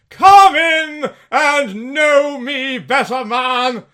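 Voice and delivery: loud, high, booming spirit voice; booming ghost. Acted. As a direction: boom a giant's welcome. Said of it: "exclaimed the ghost".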